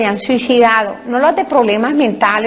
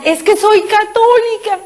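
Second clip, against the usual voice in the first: high, slow pleading cry; desperate cry; 8 semitones higher than usual. Retold or imitated. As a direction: cry out her faith to be saved. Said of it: "a gritar". (grito desesperado y lento)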